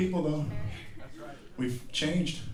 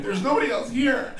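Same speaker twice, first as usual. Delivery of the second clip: much higher, baffled protest; incredulous retort; 10 semitones higher than usual. Retold or imitated. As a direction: squeak it back in disbelief.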